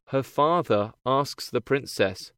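plain reading voice

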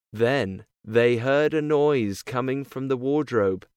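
ordinary delivery

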